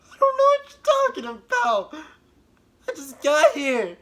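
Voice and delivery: high-pitched mock innocence; high innocent voice. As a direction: squeak out a fake denial. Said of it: "And again".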